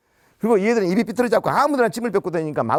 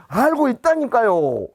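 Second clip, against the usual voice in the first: slow exasperated reply; 5 semitones higher, 3 dB louder, much slower than usual. Retold or imitated. Wife keeps calling.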